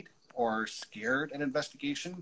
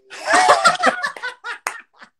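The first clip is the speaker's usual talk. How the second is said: big laugh; 12 dB louder than usual. Real, not acted. Embarrassed laughing fit.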